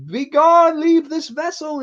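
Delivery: loud, very high mock exorcism; over-the-top priest casting out the devil; exorcising priest. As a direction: command the demon out, hammed-up and shrieky high. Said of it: "he's, like, trying to be like".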